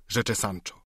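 ordinary delivery

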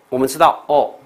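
ordinary delivery

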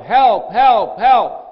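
loud, high calls for help from down a hole; shouting for help. Retold or imitated. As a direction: call up out of the pit for help. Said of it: "he would shout".

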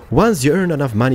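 ordinary delivery

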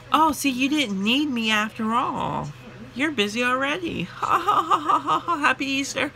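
high, gloating laugh as the woman; smug customer. Retold or imitated. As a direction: gloat like the customer who won. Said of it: "she's like".

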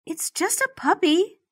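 a gentle, high whisper. Acted. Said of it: "Penelope whispers".

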